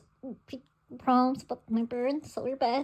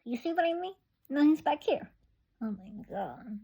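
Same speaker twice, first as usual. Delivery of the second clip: ordinary delivery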